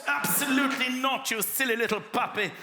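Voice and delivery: high, loud scornful tirade; haughty shouting dog. Pretend. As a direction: sneer down at the puppy. Haughty German shepherd.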